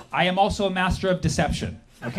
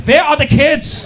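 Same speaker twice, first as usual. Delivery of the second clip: mock German accent, louder and slow; 8 semitones higher, 10 dB louder than usual. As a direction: bark it in a hammed-up German accent. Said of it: "needed at that point were German accents".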